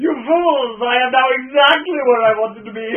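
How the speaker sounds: loud, high gloating villain; villain reveal. Acted. Plot twist villain reveal.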